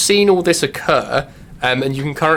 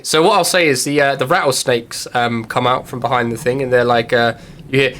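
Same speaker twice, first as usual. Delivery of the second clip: normal talking voice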